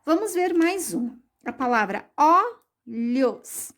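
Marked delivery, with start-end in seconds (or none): 2.1-3.7 "olhos" broken as ó, pause, lhos; drawn-out "olhos", a clear gap after the o; split into syllables. Shown as a demonstration. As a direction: say "olhos" slowly in two separate pieces. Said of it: "A primeira sílaba".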